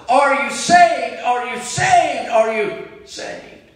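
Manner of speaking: high, loud, slow repeated call, fading on the last; John Harper calling to a drowning man; cried out. Retold or imitated. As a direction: cry it again and again over the waves. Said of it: "the preacher cried".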